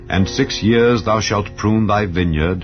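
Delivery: usual speaking voice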